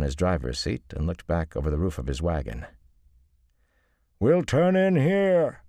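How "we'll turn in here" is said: loud, high, drawn-out bellow. A narrator's reading. Luca calling to the wagons behind, bellowing. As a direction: call it out across the wagons. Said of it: "he bellowed".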